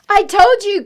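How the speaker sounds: put-on loud, high child's cry; triumphant cry. Eddie proven right, loud and high. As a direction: yell it in vindication. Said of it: "cried Eddie".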